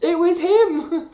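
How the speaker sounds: high, loud, voice welling up with feeling; reliving meeting her hero, choked with excitement; overcome with emotion. Real, not acted. Tells viewers her hero was the old man, overwhelmed and emotional. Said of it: "Oh, control yourself".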